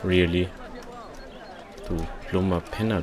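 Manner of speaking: ordinary delivery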